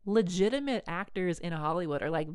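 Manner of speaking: normal talking voice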